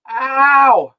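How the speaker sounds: sharp ow of burning pain; pained yelp. Real, not acted. Mouth burning from chip.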